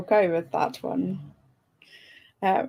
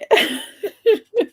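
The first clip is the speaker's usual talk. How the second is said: high laugh; 11 semitones higher, 4 dB louder than usual. Real, not acted. Laughing after teasing.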